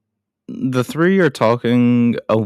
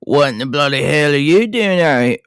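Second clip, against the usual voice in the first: hammed-up Australian accent, pitched higher; 4 semitones higher than usual. Podcaster voices Mick Taylor's first line, attempted Australian accent. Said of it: "please forgive me, any Australians".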